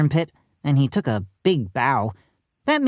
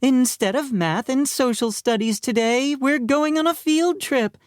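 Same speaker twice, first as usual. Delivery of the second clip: high-pitched teacher announcement; teacher announcing; 8 semitones higher than usual. A narrator's reading. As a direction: announce a treat to the class in a high voice. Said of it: "Miss Banks announced".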